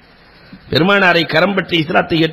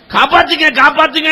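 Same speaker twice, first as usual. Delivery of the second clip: crying for help; 10 semitones higher than usual. Retold or imitated. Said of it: "என்று அலறிய அலறல்".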